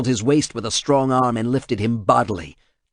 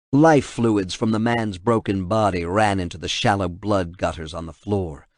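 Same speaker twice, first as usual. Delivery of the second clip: plain reading voice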